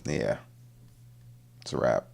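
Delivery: low, soft mock resignation; resigned defeat. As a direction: let it drop quietly, deflated. Man's resigned reaction at home.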